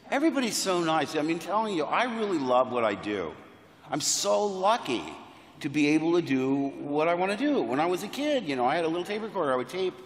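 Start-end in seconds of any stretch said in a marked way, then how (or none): none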